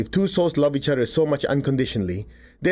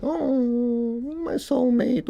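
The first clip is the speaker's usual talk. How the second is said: much higher, slow dreamy mock gush; swooning mock lover; 11 semitones higher, much slower than usual. Mocking someone who feels a soulmate connection, high dreamy swoon. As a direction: gush like a lovestruck teen, dragging it out.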